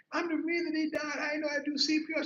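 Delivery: high, hurried self-blame; mock crying. Grieving teen confessing to his sister, mock crying. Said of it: "I was crying".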